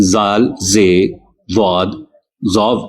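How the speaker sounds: زال، زے، ضاد، ظاف each clearly different, slowly; distinct Arabic letters. Shown as a demonstration. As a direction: keep ذال ز ض ظ apart one by one. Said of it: "فرق کو واضح رکھا جاتا ہے". (ذال، زے، ضاد، ظا کا فرق واضح کرنا)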